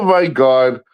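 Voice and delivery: ordinary talk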